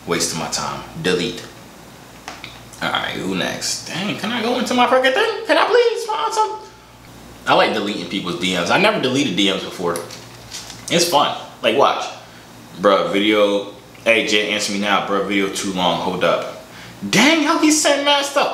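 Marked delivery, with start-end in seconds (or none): 3.9-6.7 high, whiny complaint; frustrated whine. Real, not acted. 17.1-18.5 high surprised exclaim. Real, not acted.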